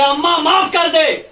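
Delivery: high, slow, loud pleading son. Acted. Son begs mother forgiveness.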